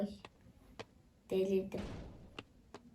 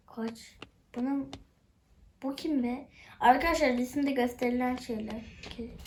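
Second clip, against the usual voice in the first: usual speaking voice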